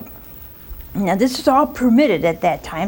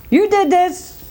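accusing wife; 8 semitones higher than usual. Acted. Sarah blaming Abraham.

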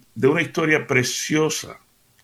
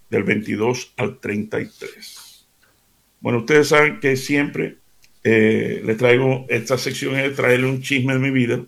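ordinary talk, nothing marked

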